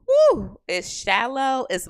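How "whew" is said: high, loud exasperated sigh word; exasperated whew. Real, not acted. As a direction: blow it out in exasperation. Exasperated whew about dating.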